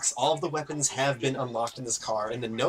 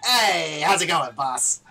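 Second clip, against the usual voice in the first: louder, chummy skeleton greeting; lightly voiced jokey skeleton; 4 semitones higher, 7 dB louder, slower than usual. Skeleton greets its summoner, chummy. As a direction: greet the boss like an easygoing wisecracker.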